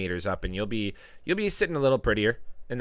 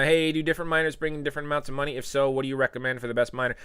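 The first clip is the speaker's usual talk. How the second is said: ordinary talk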